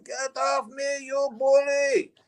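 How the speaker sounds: put-on high, slow Swedish accent rising to a shout; fake Swedish accent. Robber shouting at arresting cops, high fake Swedish accent. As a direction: yell it like an outraged Swedish tourist. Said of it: "you put on the voice".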